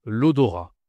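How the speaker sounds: "L'odorat" ending on a, final t silent; careful teacher model of "L'odorat"; model word. Shown as a demonstration. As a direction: say "L'odorat" with no t at the end. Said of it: "tu ne prononces pas le T ici".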